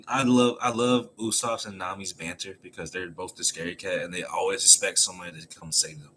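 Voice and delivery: ordinary delivery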